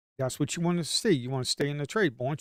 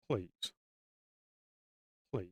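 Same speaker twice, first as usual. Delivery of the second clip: usual speaking voice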